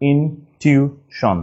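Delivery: slow "intuition" in separate pieces; syllable by syllable. Shown as a demonstration. Teacher dictating for writing, "intuition" slowly broken up. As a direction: split "intuition" with pauses between syllables. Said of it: "just একটু ভেঙে নিব".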